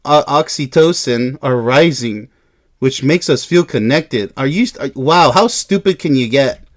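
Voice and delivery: normal talking voice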